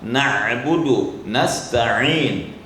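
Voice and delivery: slow Na'budu and nasta'in with the ain from the middle of the throat; slow, careful ain in Na'budu; ain pronounced. Shown as a demonstration. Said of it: "Di tengah kerongkongan".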